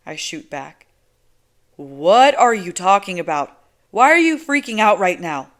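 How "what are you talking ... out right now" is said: loud, exasperated pushback; exasperated loud retort. A narrator's reading. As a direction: snap back at her in disbelief. Narrator shoots back loudly.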